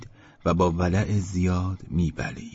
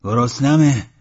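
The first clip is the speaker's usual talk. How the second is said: loud, raised-pitch holler; hungry character's cry; shout; 5 semitones higher, 8 dB louder, slower than usual. Acted. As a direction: yell it out demandingly. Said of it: "دوباره داد زد".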